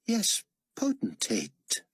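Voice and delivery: slow, high-pitched imperious correction; pointed correction. A narrator's reading. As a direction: correct an underling, drawing out "Yes, Potentate" up high. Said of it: "Carpathia corrected".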